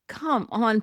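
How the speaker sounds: ordinary delivery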